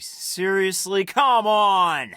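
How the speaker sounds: high, stretched-out groan of disbelief; you can't be serious, come on!; exasperated disbelief. Acted. Handler exasperated at agent's request.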